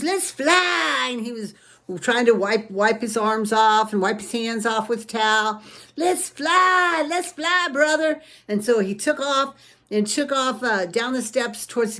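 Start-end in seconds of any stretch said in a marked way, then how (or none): 0.0-1.1 put-on high, louder kid voice; eager little kid. A narrator's reading. Little brother urges big brother to fly, high kid voice. 6.0-8.2 put-on high, louder kid voice; eager little kid. A narrator's reading. Little brother urges big brother to fly, high kid voice.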